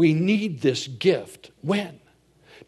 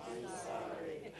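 whispered sorry; 20 dB quieter, slower than usual. Retold or imitated. Quoting the seventies saying.

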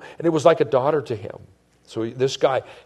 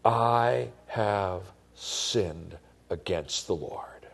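broken confession; 4 semitones lower, 5 dB quieter, much slower than usual. Acted. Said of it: "David said to Nathan".

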